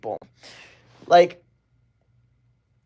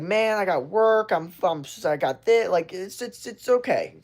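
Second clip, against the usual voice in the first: mock defensive friend; 5 semitones higher than usual. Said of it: "they start getting defensive".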